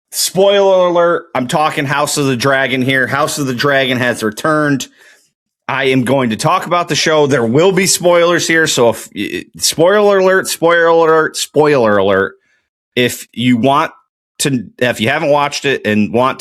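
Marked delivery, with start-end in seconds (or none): 0.1-1.3 called-out warning. Real, not acted. Calling out a spoiler warning. 9.6-12.3 louder repeated spoiler warning; announcer-style warning cry; loud warning call. Real, not acted.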